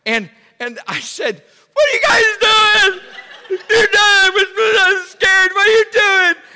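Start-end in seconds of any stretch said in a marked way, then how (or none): 1.7-6.4 very high, loud frantic shout; acted hysterical shriek at pranksters; panicked yelling. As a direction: scream at them in scared outrage. Retelling yelling at hiding brother and cousin.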